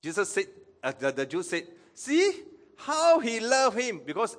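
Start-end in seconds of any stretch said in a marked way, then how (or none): 1.9-4.0 high, loud, slow exclamation; acted exclamation. Onlookers exclaiming as Jesus weeps, high moved exclamation.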